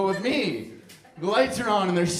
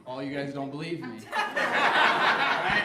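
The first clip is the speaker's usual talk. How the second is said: ordinary delivery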